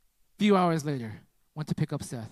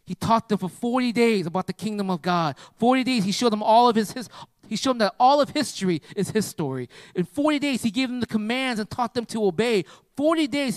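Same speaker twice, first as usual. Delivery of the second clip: raised, ringing sermon voice, pitched high; preaching at full force; 6 semitones higher, 4 dB louder than usual. Acted. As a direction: preach it out to the whole church. Preaches to congregation.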